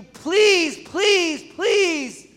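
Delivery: high, loud pleading please on his knees; desperate begging. Retold or imitated. Preacher begging the congregation, high desperate plea. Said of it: "We're begging you".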